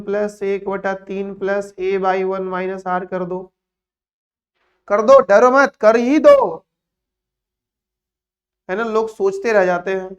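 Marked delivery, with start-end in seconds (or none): 4.9-6.4 loud encouragement. Real, not acted. Urging students loudly.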